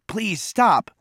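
high-pitched, desperate begging; gasped plea. A narrator's reading. Boy begs his attacker to stop kicking, gasping high. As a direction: plead through a gasp. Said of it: "Cole gasped".

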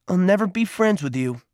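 high, bitter refusal; hurt boy's high-pitched anger; angry rejection. A narrator's reading. Peter angrily rejects Cole's offer.

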